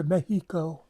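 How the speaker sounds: "Mexico" with an h for the x, "meh-HEE-ko"; would-be native pronunciation. Retold or imitated. Returning tourist trying to sound native, "Mexico" said as "Mehico". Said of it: "try to sound like all, you know, native".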